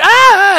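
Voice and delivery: piercing high mock scream. Kidnapped woman shrieking as she's dragged out, high scream. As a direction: shriek like being grabbed. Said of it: "They go like".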